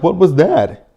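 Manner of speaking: high, loud mock alarm; mock startled Anna. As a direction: yelp it like you've been jabbed. Anna startled after being poked.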